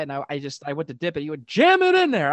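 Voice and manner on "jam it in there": very high, loud outburst; imitated excited shout; insistent urging. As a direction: push them to do it with gusto. Retold urging him to dip deeper.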